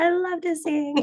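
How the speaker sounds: put-on high, eager little-kid quote; little child self. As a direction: pitch it way up like a toddler announcing it. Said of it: "the second I could talk".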